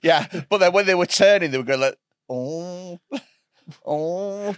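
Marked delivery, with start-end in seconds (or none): 2.3-4.5 soft, drawn-out mock zombie moan; imitated zombie groan. Comic describing film characters turning into zombies, mock groaning. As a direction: groan like someone turning undead. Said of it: "turning into a zombie".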